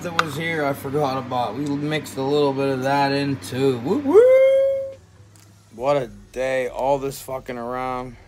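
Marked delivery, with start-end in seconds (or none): 3.8-5.0 high, loud, joyful woo; real celebratory whoop; excited whoop. Real, not acted. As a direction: cheer it out in a high yelp.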